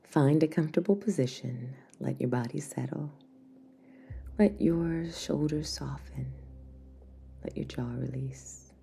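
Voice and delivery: soft spa whisper over music; guided meditation. Acted.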